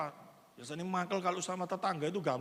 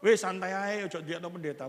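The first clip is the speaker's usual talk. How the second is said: retold call out; 9 dB louder than usual. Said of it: "Saya sudah ngomong toh".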